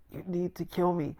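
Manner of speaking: very soft plea; feeble, hushed insistence; imitated weakness. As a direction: beg faintly, almost out of strength. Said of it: "'cause he was still kinda weak".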